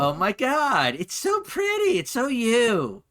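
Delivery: very high, hammed-up gush. Friend fawning over the new outfit, hammed-up gushing. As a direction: squeal with mock delight, drawing out the end.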